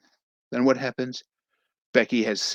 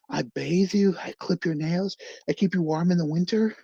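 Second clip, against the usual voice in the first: pleading with hands; 6 semitones higher than usual. Retold or imitated. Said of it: "yelling at his hands".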